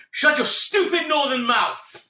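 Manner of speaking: high, slow, drawn-out angry outburst; lost temper. Retold or imitated. Retelling losing his temper at noisy passengers, angry outburst. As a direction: re-enact snapping, pitched right up. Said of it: "I completely lost my temper then".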